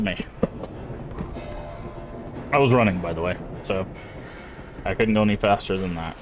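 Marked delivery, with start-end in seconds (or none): none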